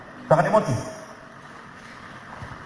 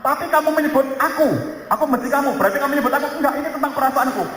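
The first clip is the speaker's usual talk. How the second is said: acted couple quarrel; 6 semitones higher, faster than usual. Acted husband-wife quarrel.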